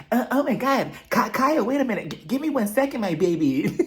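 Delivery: high, stammering mock fluster; overwhelmed put-on voice, begging for a moment; Dorit. Imitating castmate on the floor, high flustered stammer.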